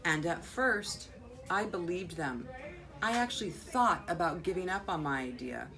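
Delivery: expressive read-aloud with swelling key words; animated picture-book reading with deliberate pauses; story narration. A narrator's reading. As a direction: read to children, punching the important words. Reads picture book aloud to children.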